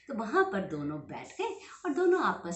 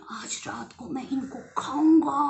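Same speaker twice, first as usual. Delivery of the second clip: sinister whispered bear; 4 semitones higher, 7 dB louder than usual. Acted. Bear's evil plan.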